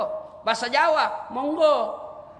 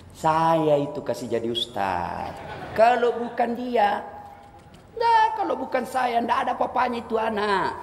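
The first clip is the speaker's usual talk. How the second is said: lightly voiced braggart; 5 semitones higher than usual. Boastful man brags about his help.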